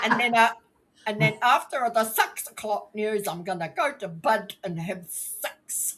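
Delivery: broad mock-Kiwi accent. Shown as a demonstration. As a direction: carry on in exaggerated Kiwi vowels. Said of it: "That's what I hear".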